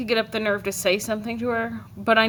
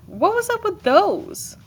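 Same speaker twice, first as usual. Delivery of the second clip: incredulous; 11 semitones higher, 3 dB louder than usual. A narrator's reading. Narrator's disbelief at grandfather.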